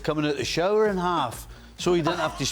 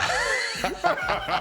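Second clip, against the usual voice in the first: a high, amused laugh. Real, not acted. Laughing at own story.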